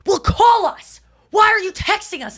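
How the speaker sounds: high, loud exasperated retort, imitated; angry parents yelling. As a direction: act out parents snapping back at their kid. Retold quarrel, parents yelling back.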